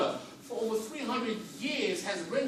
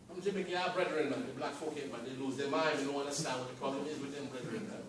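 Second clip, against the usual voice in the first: lower, softer imitation of an African immigrant; mock West African voice; 5 semitones lower, 7 dB quieter than usual. Speaker mimicking an African immigrant's scorn, mock accent. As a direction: send up the newcomer's scorn, quietly. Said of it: "I'm Nigerian".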